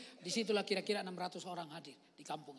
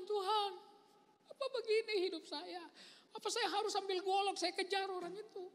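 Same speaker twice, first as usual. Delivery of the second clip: raised, pleading cry to God, retold; retold tearful prayer; 12 semitones higher than usual. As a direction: lift the voice in a tearful plea. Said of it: "Saya nangis".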